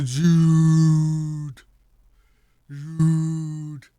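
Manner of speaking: a proud, drawn-out call of the name; cheering the scorer's name; drawn-out celebratory call. Real, not acted. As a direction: stretch out the name Jude in triumph. Celebrating Jude's goal.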